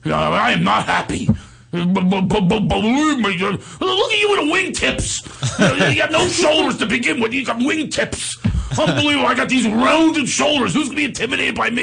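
hammed-up indignant squeal; Steiny. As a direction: sputter it out in a very high voice. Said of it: "Steiny had a really high voice".

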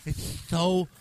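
usual speaking voice

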